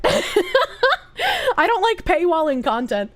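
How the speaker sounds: slow words spoken through a laugh. Real, not acted. Streamer tells chat about paywalls, laughing. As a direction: giggle the whole line out slowly.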